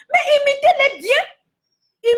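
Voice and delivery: high, slow, loud near-wail; pleading cry. Real, not acted. A singer begging TV hosts to imitate well, wailing. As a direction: stretch it out in desperate plea. Scolding hosts who copy.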